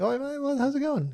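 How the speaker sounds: high, bright-pitched mock British greeting; fake British accent. Greeting the guy he lied to, in fake British. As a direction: put on a chirpy British voice. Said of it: "And so I was like".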